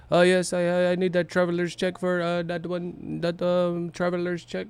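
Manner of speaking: put-on asking for traveler's checks in a Pacquiao accent; Pacquiao impression. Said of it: "talking like fucking Pacquiao".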